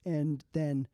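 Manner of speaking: normal talking voice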